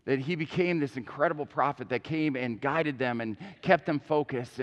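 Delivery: ordinary talk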